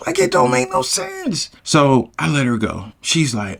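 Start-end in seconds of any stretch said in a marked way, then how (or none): 0.1-1.5 exasperated squeak. Retold or imitated. Exasperated at himself.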